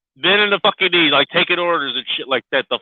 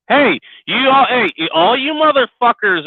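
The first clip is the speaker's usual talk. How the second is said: calling out loudly; 10 semitones higher, 4 dB louder than usual. Real, not acted. Rant calling out to listeners.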